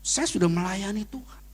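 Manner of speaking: mild pleading prayer, rising high; a touch of a tearful plea to God. Retold or imitated. Pastor retelling his prayer to God, mildly pleading and high. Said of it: "saya nangis depan Tuhan".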